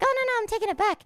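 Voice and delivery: high and fast brush-off; quickly fobbing off the nosy driver; hasty dodge. Retold or imitated.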